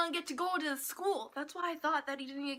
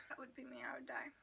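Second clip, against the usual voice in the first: whisper; 13 dB quieter, faster than usual. Real, not acted. Whispered aside.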